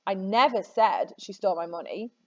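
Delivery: "never" made the strongest word; stress on NEVER. Shown as a demonstration. Teacher shows students how meaning shifts, stressing "never". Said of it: "I didn't ever say it".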